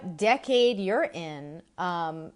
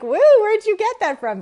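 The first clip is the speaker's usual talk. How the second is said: much higher, much louder mock surprise; surprised exclaim; 14 dB louder than usual. Mother reacting to daughter's New York accent, surprised whoop. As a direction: whoop it out, playfully amazed. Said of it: "And I'm like".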